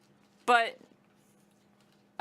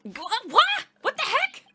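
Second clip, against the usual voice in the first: startled; 14 semitones higher, 8 dB louder than usual. Acted. Startled in-game reaction.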